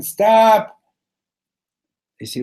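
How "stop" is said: high, loud halting shout; scream. Acted. Newcomer halting Kiddush yet another time, screaming. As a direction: scream to break off the blessing. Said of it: "he screams again".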